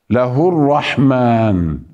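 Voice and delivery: ordinary delivery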